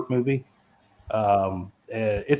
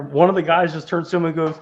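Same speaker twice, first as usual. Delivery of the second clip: ordinary talk, nothing marked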